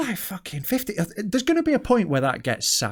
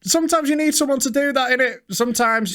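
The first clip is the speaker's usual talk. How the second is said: hard man voice; 9 semitones higher than usual. Pretend. Mock thug wisdom voice.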